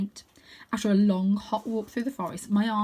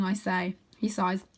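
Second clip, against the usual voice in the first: usual speaking voice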